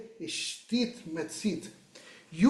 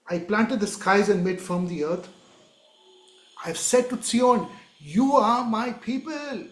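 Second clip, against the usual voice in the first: raised, solemn voice of God; declaiming scripture; 3 semitones higher, 3 dB louder than usual. Acted. Preacher voicing God speaking to Zion, solemn declaiming. Reads God's words.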